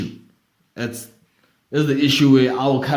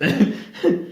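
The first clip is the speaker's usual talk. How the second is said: breathy laugh; 6 semitones higher than usual. Real, not acted. Laughs at own accent.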